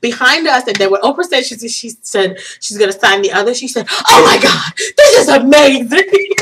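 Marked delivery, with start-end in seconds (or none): 3.9-6.3 high, loud gushing, close to tears; imitated amazement. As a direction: imitate a fan overcome with awe. Quotes big man overwhelmed by Oprah.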